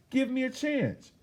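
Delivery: put-on high, pleading young woman's voice; pleading Shirley. Young Shirley begs the interviewer for the job, high and pleading. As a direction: beg for the chance, voice lifted high.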